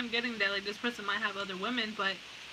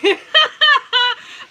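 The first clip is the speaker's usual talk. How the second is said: high, loud burst of laughter; 16 dB louder than usual. Real, not acted. Breaks into loud laughter at the question. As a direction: let a big laugh burst out.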